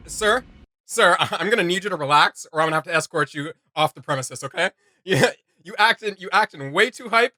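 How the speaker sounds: ordinary talk, nothing marked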